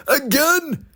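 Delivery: high sing-song taunt; mocking jeer. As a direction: laugh at Sir Handel losing. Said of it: "shouted Duncan".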